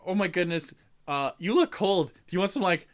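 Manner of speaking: high, doting elf voice rising on the offer; fussing elf. Retold or imitated. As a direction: coo over the cold child like a kindly elf. Elf fussing over cold child.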